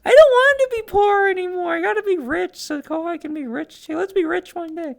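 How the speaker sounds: high, loud mock-whiny cries; excited wailing. Real, not acted. Streamer wails to her friend Kohai about being poor, high and loud.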